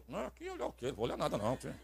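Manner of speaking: muttered very softly; quiet grumbling refusal; under breath. Retold or imitated. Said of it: "eu não tô falando alto".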